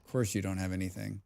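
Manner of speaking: low, quick aside; quiet, hurried remark; muttered complaint. Real, not acted. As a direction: grumble it quickly under your breath. First muttered take, then repeated.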